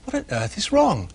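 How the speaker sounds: concerned ladybird. Acted. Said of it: "she asked".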